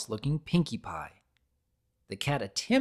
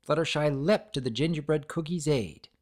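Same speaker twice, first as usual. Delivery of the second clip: usual speaking voice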